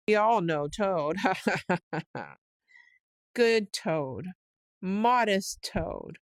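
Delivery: drawn-out sneer at the absent Toad; sarcastic mockery. Chief weasel mocks Toad to crowd.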